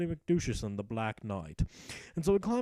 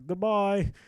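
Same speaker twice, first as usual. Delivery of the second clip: ordinary talk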